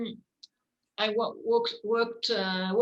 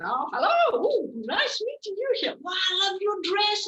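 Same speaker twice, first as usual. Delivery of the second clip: high, bright-eyed babble, much higher and louder; babbling fake cheer; 11 semitones higher, 5 dB louder than usual. To the grieving mother, playing innocent, gushing and high-pitched. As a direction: gush on as if she knows nothing. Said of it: "I just went on babbling".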